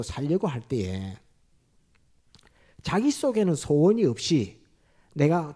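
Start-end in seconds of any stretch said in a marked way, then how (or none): none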